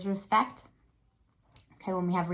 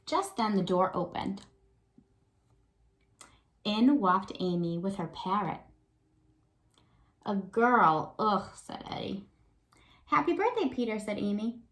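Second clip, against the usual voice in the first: put-on lively read-aloud for young kids; story narration; 2 semitones higher than usual. A narrator's reading. Teacher reads picture book aloud.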